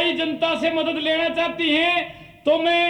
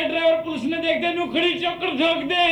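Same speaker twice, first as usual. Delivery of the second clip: ordinary talk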